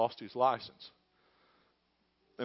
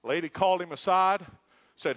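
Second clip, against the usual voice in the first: usual speaking voice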